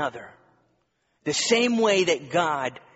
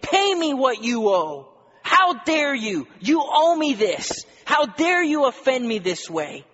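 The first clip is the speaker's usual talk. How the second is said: high, loud, furious demand; angry shout; 10 semitones higher, 8 dB louder than usual. Acted. Servant shouting at a fellow servant who owes him, furious. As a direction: throttle him with the words. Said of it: "took him by the throat, saying".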